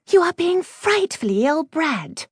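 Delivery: high-pitched, prim scolding; scandalised schoolgirl reproach; protesting. A narrator's reading. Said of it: "Octavia protested, adding".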